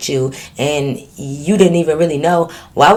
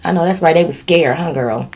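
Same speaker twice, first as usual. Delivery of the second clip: usual speaking voice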